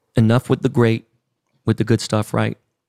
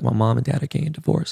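ordinary talk, nothing marked

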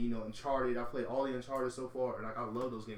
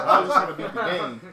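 laughing; 4 semitones higher, 15 dB louder than usual. Real, not acted. Laughing through his point.

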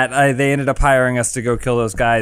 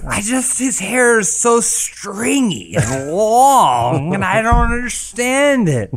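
high, drawn-out lament; overblown, stretched-out complaint; rambling storyteller; 7 semitones higher, slower than usual. Acted. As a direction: ramble on in slow bewilderment. Drunken montage of the endless tale.

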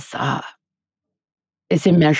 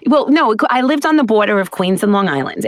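ordinary talk

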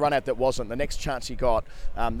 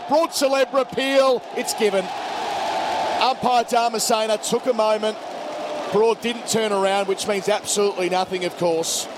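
lively ball-by-ball call, higher; cricket commentator; 8 semitones higher, 4 dB louder than usual. Acted. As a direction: call the appeal with rising excitement. Live call of lbw.

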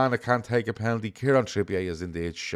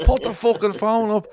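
high, loud, fed-up yell; exasperated shout; 13 semitones higher, 6 dB louder than usual. Retold or imitated. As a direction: bark it in frustration. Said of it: "you're like".

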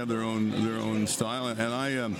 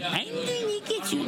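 very high, slow cackling witch. Retold or imitated. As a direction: menace them like a storybook witch. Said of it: "And then the witch said".

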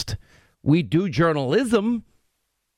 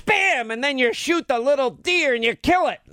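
high, hammed-up New York movie rant; Marisa Tomei movie impression; 9 semitones higher than usual. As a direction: burst in on a sudden high bang. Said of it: "That's pretty good, [name]".

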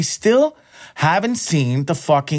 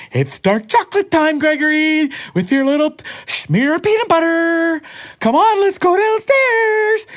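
very high, slow sing-song pet voice; baby talk to the dog; 3 dB louder than usual. Acted. Owner calling his dog Gregory down for treat time, in very high baby talk. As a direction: coo it up high like calling a puppy.

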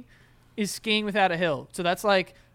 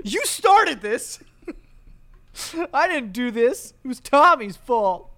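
high, mock-distraught protest; protesting girlfriend; 5 semitones higher, 6 dB louder, slower than usual. Girl at the fight blaming Tommy, high mock-distraught protest. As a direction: whine the blame away up high.

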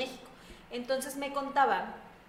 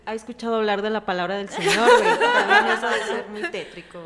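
laughing joke; 11 dB louder than usual. Real, not acted. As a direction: crack up loudly at your own joke. Jokes about visitors, bursts out laughing.

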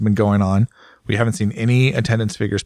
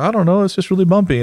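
mock rider answer; 9 semitones higher, faster than usual. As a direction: shrug it off in a raised mock voice. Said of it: "And they're like".

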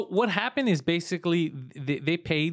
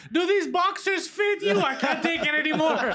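mock voice; 11 semitones higher than usual. Friend mocking the mom during the call, high silly voice. Mocking agent/mother mashup.

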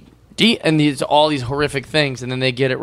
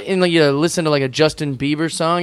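ordinary talk

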